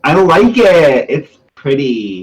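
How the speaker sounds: slow, high put-on delight; cutesy put-on voice. Player admiring the new outfit, cutesy put-on voice. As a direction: start high and loud, drawing it out. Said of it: "You do that very well".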